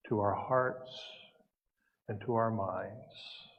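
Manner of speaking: calm prayer with long, unhurried pauses; guided meditation. Real, not acted. Minister leading the congregation into peace, calm meditative prayer. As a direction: lead them gently into stillness, pausing between phrases.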